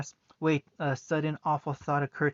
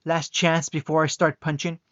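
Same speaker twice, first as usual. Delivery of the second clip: angry threat; 7 dB louder than usual. Acted. Sister threatening.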